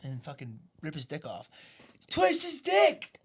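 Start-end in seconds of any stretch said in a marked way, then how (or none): 2.1-3.1 high, drawn-out heckler's yell; mock crowd shout, pitched way up; imitated yell. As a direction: holler it slowly like a heckler. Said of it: "this guy that just yells".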